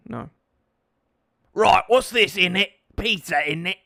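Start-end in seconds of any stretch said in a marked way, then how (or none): 1.5-3.8 louder hammed-up Cockney voice; a dodgy Cockney bloke; mock Cockney accent. Streamer doing a dodgy Cockney for chat, hammed up. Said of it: "dodgy Cockney accent is in it".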